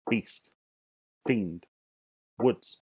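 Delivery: each word as a single beat; one part each. Shown as a demonstration. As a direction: say each word whole, pausing between them. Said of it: "just say them in one part".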